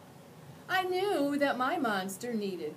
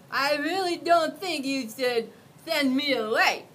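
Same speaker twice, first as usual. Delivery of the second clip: louder, ominous warning; 7 semitones higher, 10 dB louder than usual. Acted. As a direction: make it a threat. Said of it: "he warned".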